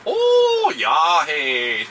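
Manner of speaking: high Yooper neighbor yahoo; Yooper accent. Retold or imitated. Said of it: "The neighbors go".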